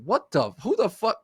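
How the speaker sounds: high-pitched incredulous challenge; indignant comeback. Retold or imitated. Acted comeback in retold quarrel.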